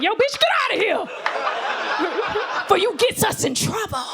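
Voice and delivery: high, hammed-up panicky holler; mock alarmed yell. Construction workers chasing off a woman, mock alarmed yell. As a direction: shoo someone off in a comic panic.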